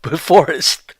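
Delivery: high, giggly voice breaking up; reading the news through laughter; laughing while reading. Real, not acted. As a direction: try to read through the giggles. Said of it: "I don't know why I'm laughing".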